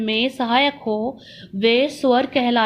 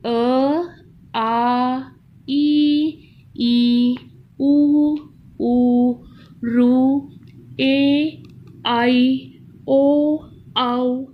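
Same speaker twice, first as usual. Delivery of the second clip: each vowel called out louder, one by one; louder classroom chant of the vowels; loud vowel drill; much slower than usual. Shown as a demonstration. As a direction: lead the class in reciting the vowels. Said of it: "और एक बार पढ़ेंगे".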